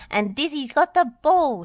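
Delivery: high, loud playful commentary; game announcer glee; excited cry. A narrator's reading. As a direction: cheer it like a match commentator. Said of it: "she cried".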